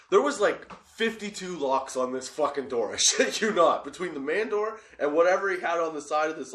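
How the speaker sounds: ordinary delivery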